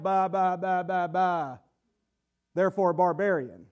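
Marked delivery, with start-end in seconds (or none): 0.0-1.6 low, dumb-sounding ba-ba babble; mocking babble. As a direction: mimic foreigners who can't speak Greek. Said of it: "to them they sound like".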